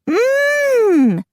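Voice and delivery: long, high "mm"; appreciative hum. A narrator's reading. Macy eyes Jack up, high savoring hum. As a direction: hum with delighted relish.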